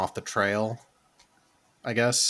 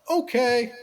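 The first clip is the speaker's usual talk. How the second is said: high, drawn-out okay; mock easy acceptance; 13 semitones higher, 3 dB louder than usual. Host imitates crew letting a woman hop on, mock acceptance. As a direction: imitate a crew agreeing far too readily, pitched way up.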